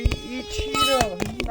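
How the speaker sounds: high, slow drawn-out sing-song; sing-song coaxing. Real, not acted. Parent luring toddler to eat, slow high sing-song.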